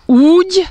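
úgy on the vowel, high and loud; úgy without H. Shown as a demonstration. Teacher shows úgy with no H. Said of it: "entendez la différence".

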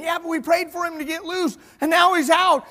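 high, insistent, quickening argument; excited protest. Retold or imitated. Rhoda arguing Peter was freed, excited protest. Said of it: "she gets all excited".

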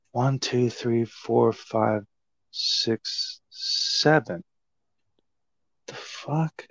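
soft, hushed tallying to yourself, trailing into bafflement; quiet puzzled counting. Retold or imitated. Wrestler counting his joints left on the table, quiet and puzzled. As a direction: count slowly under your breath.

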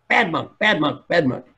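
mildly acted indignation; angry monk. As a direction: chant the reproach like a cross monk. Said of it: "his colleague is still angry".

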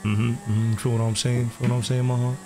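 smooth hustler character. Acted. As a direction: play a smooth-talking record label boss. Plays RP character Tay Cardi.

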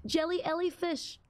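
ordinary delivery

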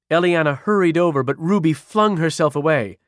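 plain reading voice

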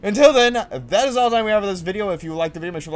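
loud, high sign-off announcer voice; big hype outro; YouTuber outro. Acted.

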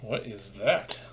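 ordinary talk

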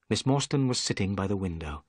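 usual speaking voice

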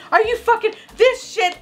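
high-pitched disbelief at a twenty-five dollar glue; outraged squeak. Real, not acted. Outraged at the price.

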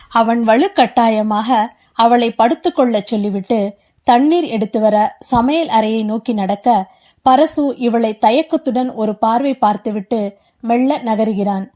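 usual speaking voice

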